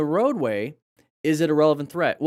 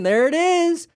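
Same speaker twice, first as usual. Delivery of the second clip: excited; 6 dB louder, slower than usual. Real, not acted. Spotting the rider's move on stream.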